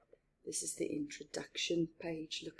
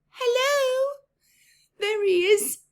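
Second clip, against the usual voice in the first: much higher and louder, delighted call; excited greeting; 12 dB louder than usual. Real, not acted. Spotting the mouse in picture.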